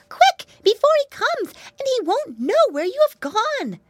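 high, squeaky hurried mouse; crying out urgently. Acted. Mousy girl hurries the boys to hide, squeaky urgent cry. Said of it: "she cried".

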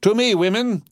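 very high rallying call; crying out. A narrator's reading. Madame calling the women to her, crying out. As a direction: summon followers with a high-pitched cry. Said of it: "cried Madame".

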